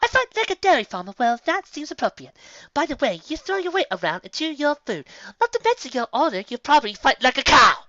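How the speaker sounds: high, haughty comeback; refined comeback. A narrator's reading. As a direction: toss the insult back with a sniff. Rarity's witty comeback.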